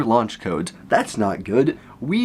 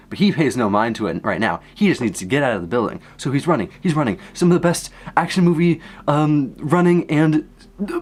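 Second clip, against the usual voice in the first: usual speaking voice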